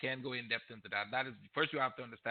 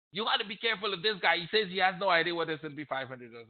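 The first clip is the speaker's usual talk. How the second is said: a mocking, higher put-on voice for the smart guy; mocking know-it-all; 5 semitones higher, 4 dB louder than usual. Viewer mimicking a critic's snide warning, higher mocking tone. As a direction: sneer his warning back at him. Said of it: "I should say, in inverted comma".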